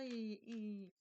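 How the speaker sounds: ordinary talk, nothing marked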